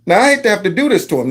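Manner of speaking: ordinary talk, nothing marked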